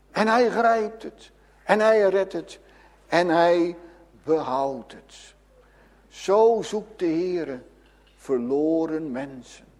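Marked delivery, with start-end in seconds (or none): none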